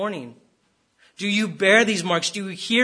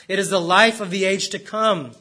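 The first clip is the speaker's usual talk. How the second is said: ordinary talk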